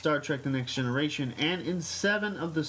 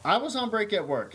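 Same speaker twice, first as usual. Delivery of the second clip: usual speaking voice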